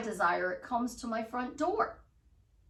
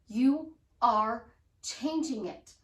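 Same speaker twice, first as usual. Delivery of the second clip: ordinary talk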